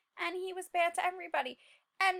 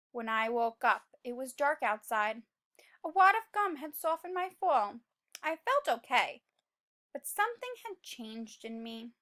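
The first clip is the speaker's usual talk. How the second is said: story narration; 6 semitones lower than usual. A narrator's reading. Teacher reading the seed's change to children, hushed narration. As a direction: read it darkly to the kids.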